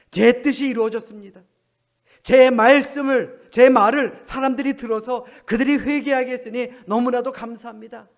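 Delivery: loud, high, overjoyed prayer of thanks; joyful thanks. Pretend. Jonah thanking God, loud and overjoyed. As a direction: pour out glad thanks to God.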